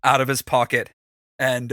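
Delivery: ordinary talk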